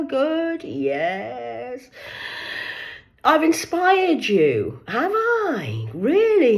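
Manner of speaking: bright fake-cheery phone manner; sweet phone voice. Retells next day's call.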